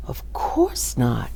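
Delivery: slow whispered denial; hushed denial. A narrator's reading. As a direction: soothe her with a whisper. Stranger soothing.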